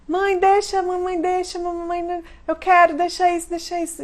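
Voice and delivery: put-on high, pleading kid voice; mock child begging. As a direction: whine to be allowed.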